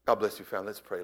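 usual speaking voice

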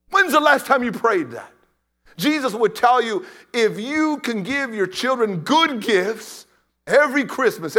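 loud, driving sermon delivery; full-force preaching. Acted. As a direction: preach it hard to the congregation.

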